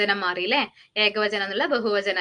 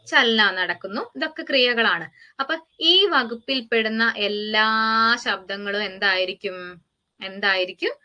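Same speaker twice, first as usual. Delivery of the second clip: normal talking voice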